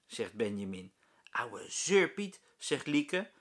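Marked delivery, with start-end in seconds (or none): 1.3-2.4 slow, drawn-out sneer; scornful grumble. A narrator's reading. Lieke sneers about the teacher, slow. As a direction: drag out the insult.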